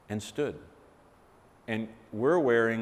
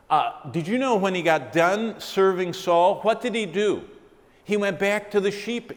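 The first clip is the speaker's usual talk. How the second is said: higher, louder, driving sermon voice; fervent preaching; 8 semitones higher, 6 dB louder than usual. Acted. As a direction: preach it to the congregation with fire. Preaching at full force.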